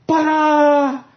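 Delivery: loud, high fanfare shout; triumphant ta-da. Retold or imitated. Husband bursting from the bathroom, a loud high ta-da. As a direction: burst in like a showman.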